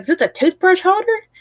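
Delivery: high, confused voice of a dad; lightly voiced dad asking in confusion; puzzled dad. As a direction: imitate a baffled father squinting at it.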